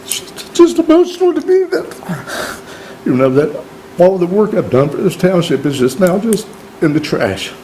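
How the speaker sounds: real tears, voice cracking up; crying. Real, not acted. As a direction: voice breaking high through tears. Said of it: "I'm sorry I'm crying".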